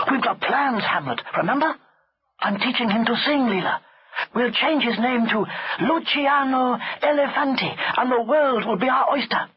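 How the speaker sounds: put-on high, upbeat boy's voice; eager servant boy. A narrator's reading. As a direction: cheer the elephant with grand plans. Chulak shares the plans.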